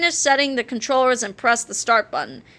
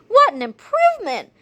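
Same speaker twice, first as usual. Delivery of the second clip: high, pleased mom voice; a chirpy mother, thrilled; delighted mom voice; 9 semitones higher, 3 dB louder than usual. A narrator's reading.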